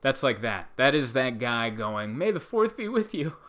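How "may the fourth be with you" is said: forced cheer. Retold or imitated. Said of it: "He's crying on the inside".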